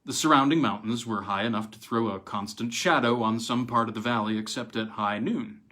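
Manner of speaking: ordinary delivery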